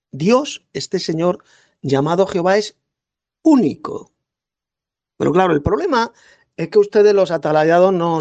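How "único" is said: único lifted high and held on its own; emphatic único. Acted. Debater hammering his opponent with the key word, único stressed high. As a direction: land the word único as the point, up high then falling.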